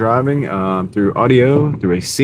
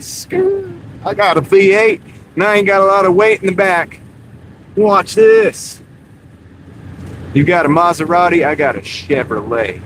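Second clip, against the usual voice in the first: cocky pickup driver in a higher, hammed-up country accent; put-on country accent; 6 semitones higher than usual. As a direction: brag like a truck guy in a thick country accent. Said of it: "I don't know why I'm doing that accent".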